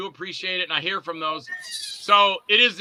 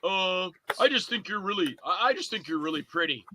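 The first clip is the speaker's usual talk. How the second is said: lightly voiced goofy guy; hesitant, stumbling compliment in a goofy voice; 4 dB quieter than usual. Goofy guy in the back seat to the girlfriend, lightly goofy. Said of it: "where the goofy guy's in the back going".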